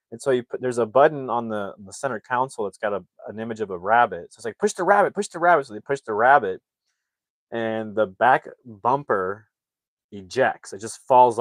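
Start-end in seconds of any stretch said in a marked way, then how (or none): 4.6-5.7 high, insistent chant; mock urgent urging. Narrator as family urging dad at the button, urgent urging. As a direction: egg the driver on.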